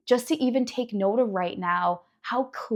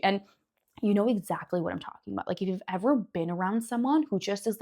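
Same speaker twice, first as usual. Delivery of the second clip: ordinary delivery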